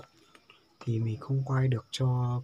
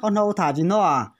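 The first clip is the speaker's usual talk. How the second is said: to someone hard of hearing; 6 semitones higher, 10 dB louder than usual. Real, not acted. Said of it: "phải nói to to bà cụ mới nghe được".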